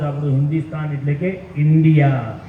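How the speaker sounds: ordinary talk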